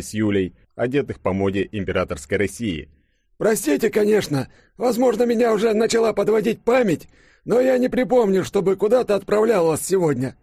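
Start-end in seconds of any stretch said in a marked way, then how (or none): 3.4-10.4 put-on very high, bewildered old professor; flustered, high-pitched elderly character; Лыков. A narrator's reading. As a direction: protest in a reedy high old man's voice. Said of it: "Глаза старика бегали".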